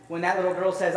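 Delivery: normal talking voice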